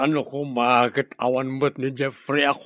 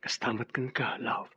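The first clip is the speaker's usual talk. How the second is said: soft whisper; 2 semitones lower, 7 dB quieter than usual. Acted. Husband whispers back to wife.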